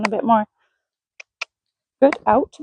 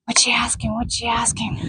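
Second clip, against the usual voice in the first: whispered, curious horse thinking to himself; horse's thoughts; 5 dB quieter than usual. Retold or imitated. Owner voicing her horse figuring out the cue, whispered. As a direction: breathe it out as the puzzled horse.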